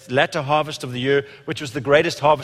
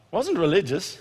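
ordinary delivery

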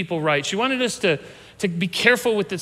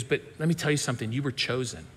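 soft, hushed and quick, then gentle; tender mom; 6 semitones lower, 8 dB quieter, faster than usual. Retold or imitated. Mother reassuring her teased son, soft and tender. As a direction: comfort him quietly, like a mother.

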